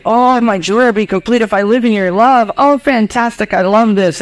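high, hammed-up delight; mock gushing joy; gushing disciples. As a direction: play a thrilled follower, pitched well up.

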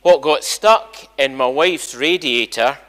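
ordinary talk

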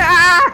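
high, loud cry of alarm; sudden yell on being hit; startled scream. Real, not acted. Player hit while chasing enemies, startled scream.

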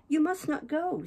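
high, pleading woman's character voice; insistent Catherine. A narrator's reading. Catherine clings to her departing lover, insistent and high. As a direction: plead with him, pitched well up.

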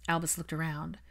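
usual speaking voice

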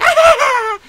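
high sharp laugh; short cackle. Acted. Doctor laughs at his own insult, high. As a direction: snort a laugh.

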